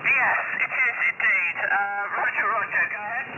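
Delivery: normal talking voice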